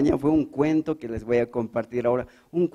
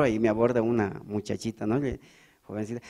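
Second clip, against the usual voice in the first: ordinary talk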